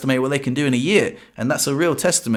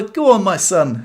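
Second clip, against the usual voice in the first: higher, slow encouraging call, lightly voiced; mock cheer; 5 semitones higher, slower than usual. Onlookers urging on the man fixing his car, slow mock cheer. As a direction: egg him on like a bloke at the pub, "Go on, my son." drawn out. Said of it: "and they were like".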